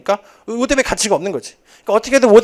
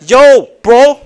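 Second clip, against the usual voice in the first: loud laughing yo bro; mock rapper greeting, much louder; hip-hop bro; 5 semitones higher, 12 dB louder than usual. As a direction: shout it like a swaggering rapper. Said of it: "막 이러면서".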